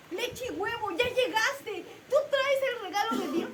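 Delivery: put-on a little boy overjoyed at the gift; joyful child. Child thanking the man at the door, joyful.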